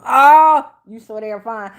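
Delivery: loud excited squeal. Real, not acted. Host reacting to a flirty viewer comment, excited squeal. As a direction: react like the line floored you.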